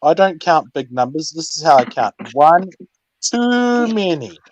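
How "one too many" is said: slow comic drawl. Acted. Comic counting joke.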